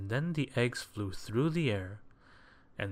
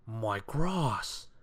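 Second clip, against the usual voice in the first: dismayed cry over something precious; pained little exclamation of horror; fussy outcry; 3 dB louder than usual. A narrator's reading. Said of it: "cried Mister Fussy".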